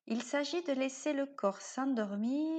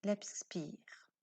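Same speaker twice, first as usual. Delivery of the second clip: whispered exhale; 3 semitones lower, 6 dB quieter than usual. Acted. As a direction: whisper "l'expire" on the out-breath. Souffle expiré chuchoté. ("l'expire" chuchoté sur l'expiration)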